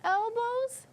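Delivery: put-on high, loud child question; Henry's voice. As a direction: pipe it up like a small boy. Reading Henry's line aloud.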